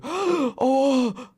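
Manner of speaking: shocked audience gasp, then high "oh!"; exaggerated high-pitched gasp. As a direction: imitate a crowd gasping in shock. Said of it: "everyone's gasping and going".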